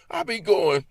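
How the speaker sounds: normal talking voice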